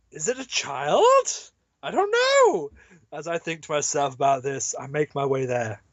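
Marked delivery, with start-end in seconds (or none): 1.8-2.7 panicked high. Acted. Panicked in-story thought.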